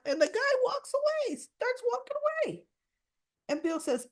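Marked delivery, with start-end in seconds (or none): 0.1-2.7 very high-pitched disbelief; high, incredulous storytelling squeal; incredulous exclamation. Real, not acted. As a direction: voice jumps way up in astonishment. Incredulous retelling of waiter leaving.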